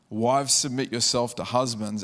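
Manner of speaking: ordinary talk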